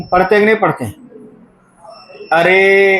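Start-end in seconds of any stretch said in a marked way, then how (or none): 2.3-3.0 अरे with the final ए stretched out; अरे held long on one level note; prolonged calling vowel. Shown as a demonstration. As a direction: draw out अरे as if calling someone. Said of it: "अगर इसे बुलाया जाए".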